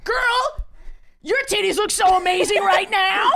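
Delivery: gushing compliment. Acted. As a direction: gush over her like a fan.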